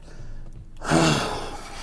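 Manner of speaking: long, soft exasperated sigh; imitated sigh. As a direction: let out a drawn-out mock sigh. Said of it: "would just sit there and just be like".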